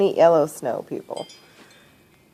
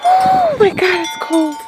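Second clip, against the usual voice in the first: high shocked cry at the cold; 13 semitones higher, 8 dB louder, slower than usual. Real, not acted. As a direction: yelp it as the cold hits. Hit by cold outside.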